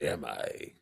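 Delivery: low creaky fry; vocal fry. Acted. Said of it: "my creepy, creepy voice".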